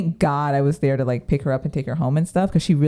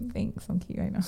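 whispered joke; 5 semitones higher, 11 dB quieter than usual. Retold or imitated. Joking aside, breathy.

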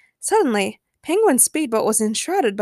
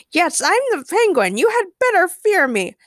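high, loud, menacing boast; hammed-up threatening shout; villain shouting; 9 semitones higher, 5 dB louder than usual. A narrator's reading. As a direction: proclaim it as a cartoon villain. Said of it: "Penguin shouted".

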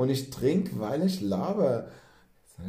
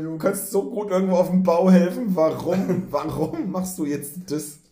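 disappointed mother; 6 semitones higher, 7 dB louder than usual. Pretend. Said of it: "wo eine Mutter denkt".

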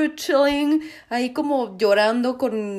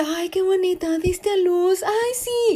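cooing; 7 semitones higher than usual. Retold or imitated. Newt cooing at the mother deer.

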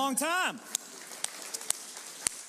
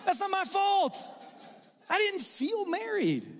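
whiny mock excuse; 10 semitones higher than usual. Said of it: "I can't say".

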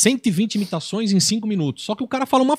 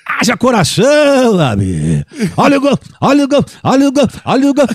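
Galvão Bueno goal call; 4 semitones higher, 8 dB louder than usual. Retold or imitated. Imitating a football commentator calling a goal, shouting. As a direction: yell the goal like a TV announcer. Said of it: "zoando de Galvão".

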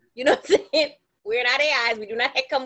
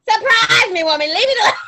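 louder, higher mock exasperation; higher and louder, fed up with her; exasperated insistence; 7 semitones higher, 8 dB louder than usual. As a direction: push back hard at mama. Retold insistence on being surprised.

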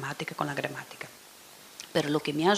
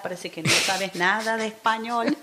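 ordinary talk, nothing marked

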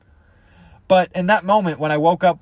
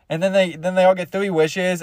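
ordinary talk

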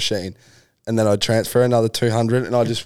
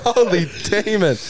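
high mock exclamation; 7 semitones higher than usual. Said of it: "I was like".

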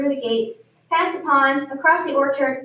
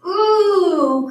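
higher, louder happy ooh; delighted ooh; 5 semitones higher, 6 dB louder than usual. A narrator's reading. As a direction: savour the sight of food. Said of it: "cried Goat".

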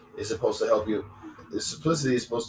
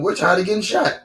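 loud, high-pitched cry of protest; fed-up protest shout; 10 semitones higher, 7 dB louder than usual. Retold or imitated. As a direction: call it out for fed-up kids. Voicing fed-up protesting kids.

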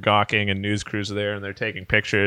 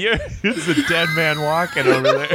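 mock threat, raised and half laughing; Bigfoot; 6 semitones higher, 3 dB louder than usual. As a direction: play an outraged creature promising revenge. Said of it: "Bigfoot is staring at Cody like".

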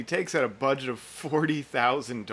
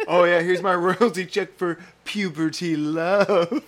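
raised, louder put-on voice breaking into laughter; mock boasting drummer; 4 semitones higher, 7 dB louder than usual. Imagining the drummer with his royalty check, loud mock pride. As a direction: show off the cheque, hammed-up and amused.

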